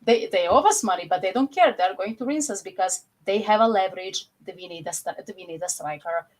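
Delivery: ordinary talk, nothing marked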